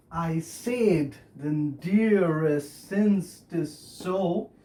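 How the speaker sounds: very slow, measured scansion; slow verse reading, foot by foot; scanned verse. Shown as a demonstration. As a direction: stretch each metrical foot out slowly. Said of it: "how does it sound?".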